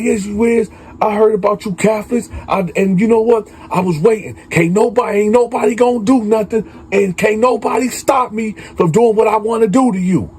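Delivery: high, fast, heated confrontation; retold angry threat. K B threatening the priest in a retold quarrel, angry.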